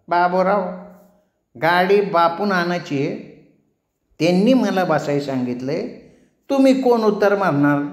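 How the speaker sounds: cheeky barber. A narrator's reading. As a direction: taunt him from safety.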